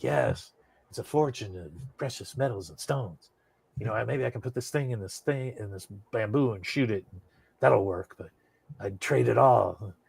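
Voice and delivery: hint of a Shatner delivery with dramatic pauses; soft mock-Kirk monologue, savoring each word. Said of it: "the way Shatner says it is just fucking poetry".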